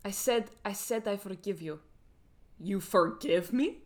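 reenacted Russian accent; Villanelle. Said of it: "I'm gonna do a reenactment".

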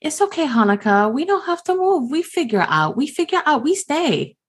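high, sweet consoling voice; childlike comforting. Acted. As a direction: soothe her like a small child would. Gim comforting crying Honoka.

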